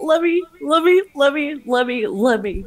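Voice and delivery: high, desperate begging; pleading. Real, not acted. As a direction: squeal wait in panic. Begging the others to stop.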